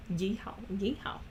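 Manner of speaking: unvoiced whisper, practising; whispered try-out. Real, not acted. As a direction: whisper it to yourself. Trying a cowboy accent, whispered.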